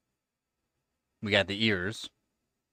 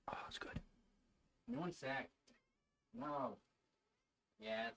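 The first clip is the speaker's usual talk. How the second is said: much softer murmured words; hushed; 20 dB quieter than usual. Real, not acted. Beside the resting cat, hushed murmurs. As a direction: keep it barely audible. Whispering near pet.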